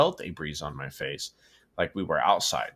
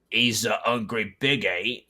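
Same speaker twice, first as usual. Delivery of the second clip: put-on pirate accent slipping; 3 semitones higher than usual. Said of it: "I lost the pirate accent".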